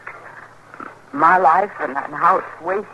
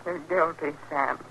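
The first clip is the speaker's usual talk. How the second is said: soft and low, drained; hushed verdict; 4 semitones lower, 10 dB quieter than usual. Acted. Wife pronouncing her dead husband guilty, soft and hollow. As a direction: say it quietly over the body. After the shot, quiet verdict.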